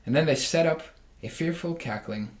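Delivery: ordinary delivery, nothing marked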